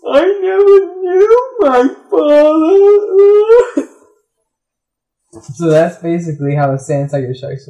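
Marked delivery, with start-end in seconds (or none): 0.1-3.9 high and loud, building to mock crying; hammed-up sob. Shark sobbing over fathers, building to fake crying. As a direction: ham up the tearful father story. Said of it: "And he's like".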